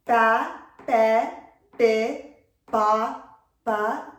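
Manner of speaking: Ba, be, bi, ba as separate, loud blended syllables; blend demonstration. Shown as a demonstration. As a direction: sound out "Ba, be, bi, ba" one by one, loudly. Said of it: "illustrate the sound to the child".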